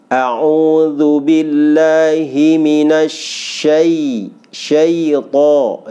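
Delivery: very slow recited A'udzu billahi minasy syaito; recitation with the error. Shown as a demonstration. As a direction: recite slowly, nasalising syaito. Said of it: "kesalahan itu".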